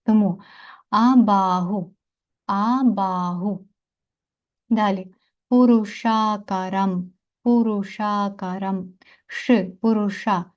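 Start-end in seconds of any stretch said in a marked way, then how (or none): 0.9-3.7 абагу drawn out on the long А and long middle vowel; slow teacher's demo of абагу, long vowels held; stress on long vowels. Shown as a demonstration. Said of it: "всі їх ми виділяємо наголосом". 5.5-8.9 Пурушакарам said slowly with both long А vowels held; careful slow Пурушакарам, ending on a clear M; stress on long vowels. Shown as a demonstration. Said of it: "всі їх ми виділяємо наголосом".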